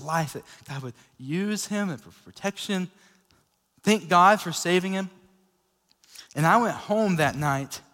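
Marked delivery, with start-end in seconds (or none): none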